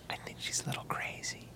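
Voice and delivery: conspiratorial whisper aside. Real, not acted. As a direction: whisper it behind her back. Whispered aside about co-host.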